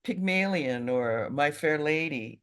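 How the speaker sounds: ordinary talk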